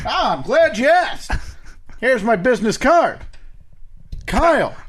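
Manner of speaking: put-on fast-talking old-time gumshoe; hard-boiled detective patter; 1930s detective voice. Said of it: "I don't know why you talk like a, a detective in the thirties".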